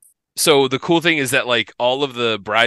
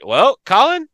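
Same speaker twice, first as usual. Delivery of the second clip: mock proud; 12 semitones higher, 3 dB louder, slower than usual.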